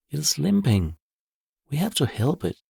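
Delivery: put-on worried child. A narrator's reading. Girl worried for squirrel.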